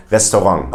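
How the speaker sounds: RESTAURANT as "res-to-RONG"; French-style RESTAURANT with German accent. Retold or imitated. Teacher imitates Germans attempting French, German accent. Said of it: "they have a German accent".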